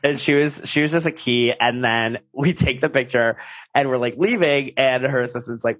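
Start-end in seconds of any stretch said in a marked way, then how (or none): none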